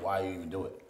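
ordinary delivery

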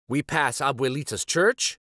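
ordinary delivery

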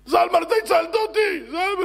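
high, loud exclamation; loud, high-pitched outburst of thanks; overjoyed gratitude. Acted. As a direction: cry out to your rescuer at full voice. Rescued man cries thanks to rescuer.

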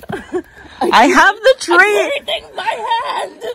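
high, breathless laughter through the words; laughing hard. Real, not acted. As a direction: laugh through it while struggling with the tree. Laughing while carrying the tree.

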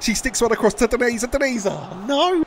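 rising pitch into a dismayed cry; high, anguished commentary; excited play-by-play. Real, not acted. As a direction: build hope, then lament the miss. Cross and missed chance.

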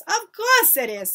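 high, loud, imperious agreement; self-assured witch. A narrator's reading. Grand High Witch accepting praise, self-assured. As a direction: take the praise as your due.

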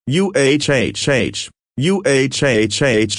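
slow, halting uhhh, sputtered out in a strange voice; startled sputter. Acted. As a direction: sputter in confusion, just waking up. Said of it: "Sheila sputtered".

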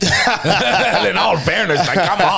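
high, slow, laughing protest; joking with host about Ronaldo's looks, laughing. Real, not acted. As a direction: laugh it out in disbelief.

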